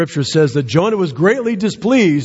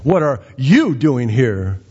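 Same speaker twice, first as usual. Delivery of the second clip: pause, then hit "you" hard; YOU raised sharply, the rest dropping away; stress on YOU; slower than usual. Acted. Repeats question stressing you.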